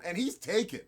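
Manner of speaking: normal talking voice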